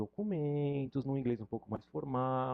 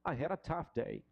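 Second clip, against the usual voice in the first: native speaker model. Shown as a demonstration. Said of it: "um nativo provavelmente falaria".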